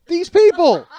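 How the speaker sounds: very high, very loud incredulous yell; outraged shout. Real, not acted. Comic repeating the doctor's words to the audience, outraged shout. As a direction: explode in laughing, high-pitched disbelief.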